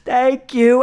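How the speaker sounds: high, squealing thank-yous; fanboying out. Pretend. Fan meeting host Phil on stream, squealing excited thanks. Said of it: "fanboying out".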